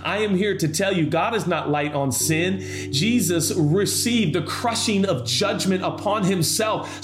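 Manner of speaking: preacher pleading with the struggling listener, impassioned; preaching at full force. Acted. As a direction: drive the altar call home with fire. Preaching climax over music.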